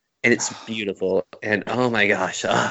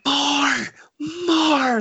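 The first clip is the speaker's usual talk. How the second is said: imitating a scream. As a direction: yell it up high like a raging villain. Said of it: "Kylo Ren screaming".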